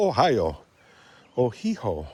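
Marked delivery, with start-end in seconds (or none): 1.4-2.1 Ohiho, with an h sound before the last o; Antina Chase's pronunciation. Retold or imitated. Vlogger playfully copying a Canadian acquaintance's way of saying Ohio, "Ohiho" as oh-HEE-ho. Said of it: "As Antina Chase would say".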